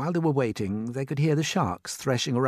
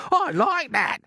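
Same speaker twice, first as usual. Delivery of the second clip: high, loud affronted retort; indignant; 6 dB louder, slower than usual. A narrator's reading. Earthworm answering the dismissive centipede, indignant. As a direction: throw it back loudly, offended.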